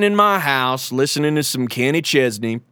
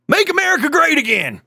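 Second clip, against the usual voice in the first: much higher rousing slogan yell; rally cry; 14 semitones higher than usual. Acted. Correspondent closing the rant to his listeners, rousing high cry. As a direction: pump up the crowd with it.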